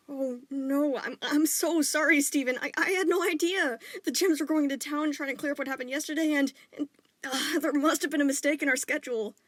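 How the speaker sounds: high, loud, stammering distress; frantic apology. A narrator's reading. Father apologizing to his son, loud and frantic. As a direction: blurt out a panicked apology.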